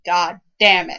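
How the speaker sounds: loud, fed-up outburst at the end of a rant; exasperated curse. Real, not acted. Cohost finishing a nitpicking rant, exasperated. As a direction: spit it out in real frustration. Ranting about game continue screens.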